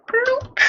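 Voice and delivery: high, rising cartoon bloop; playful bloop. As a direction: pop out a loud, squeaky-high bloop. Voicing a placed object.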